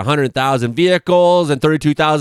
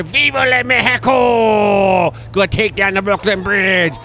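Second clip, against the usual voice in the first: hammed-up slow, high shouted rallying cry; mock battle cry; 7 semitones higher than usual. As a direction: bellow it like a triumphant invader, drawn out. Mock cry of Mexican sailors.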